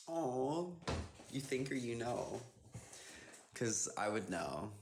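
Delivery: mock-offended, drawn-out reply; playful shade. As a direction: tease them with pretend shade. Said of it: "I'm just giving you shade".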